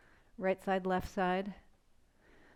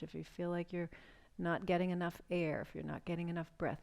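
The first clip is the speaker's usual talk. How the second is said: soothing breathwork guide; guided breathing meditation. Acted. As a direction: ease them into the breath, unhurried. Leads a guided breathing exercise.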